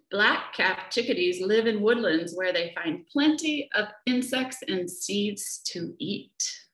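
ordinary delivery, nothing marked

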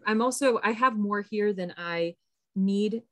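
ordinary talk, nothing marked

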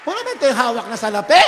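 preaching shout. Real, not acted. Preacher declares Jesus alive to congregation, triumphant shout. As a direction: belt it out to the whole church.

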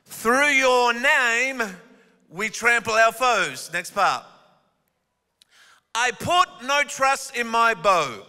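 slow scripture reading. Acted. Reads the psalm.

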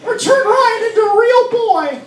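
very high, put-on voice; high-pitched joke wish. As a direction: pitch it way up for the gag. Jokey wish shouted to the magician.

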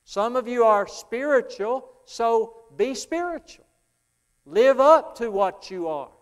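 loud, high, full-force preaching; hammering revival preacher. Acted. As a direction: drive the point home from the pulpit.

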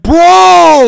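loud high shout; shouted bro. Retold or imitated. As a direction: yell it out. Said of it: "Chat is like".

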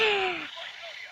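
startled intake of breath, up high; real shocked gasp; sharp high gasp. Real, not acted. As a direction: gasp in sudden fright. Nearly died in game.

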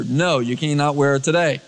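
ordinary talk, nothing marked

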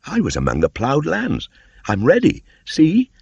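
put-on very high, loud boyish voice; a boy naming it in wonder; young Mowgli. A narrator's reading.

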